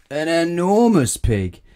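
high, loud and stretched out; booming dramatic reveal. A narrator's reading. Narrator revealing what happened next, huge dramatic reveal. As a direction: swell hugely on the transformation, slowly.

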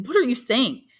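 high, loud anguished cry; a young man crying out in pain; cried out. A narrator's reading. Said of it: "cried the young man".

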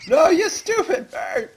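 very high, slow squeal then laugh; high squeaky outburst. Pretend. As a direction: shriek at the bird in a silly voice. Bird steals seeds.

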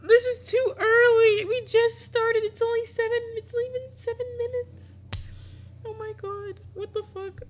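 very high, loud frightened squeal; a very high, panicked whine. Real, not acted. Panicking at the fast romance.

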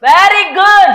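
high, loud game-show praise; loud exclamation. Real, not acted. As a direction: burst out with showy praise. Host exclaims at contestant's answer. (గట్టిగా, హెచ్చు స్వరంలో మెచ్చుకుంటూ)

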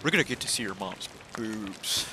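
quiet cartoon kid impression; congested cartoon kid. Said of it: "the guy was like".

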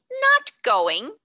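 can't believe the refusal; shocked disbelief. A narrator's reading. Headmistress stunned by refusal.

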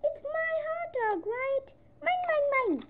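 high, loud mine mine mine; cling to the food desperately; high, whiny and insistent; possessive whine. Acted. Pigeon whining possessive.

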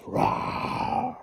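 high, loud breathy mock roar; monster roar. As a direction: give a playful monster roar. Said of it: "they roared their terrible roars".

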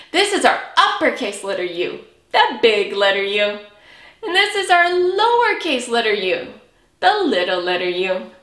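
ordinary delivery

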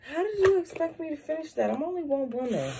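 exasperated. Retold or imitated. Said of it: "I'm like".

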